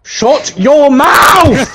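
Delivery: slow, high-pitched yell; exasperated shout. Real, not acted. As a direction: yell each word at nagging friends. Snaps at friends giving advice.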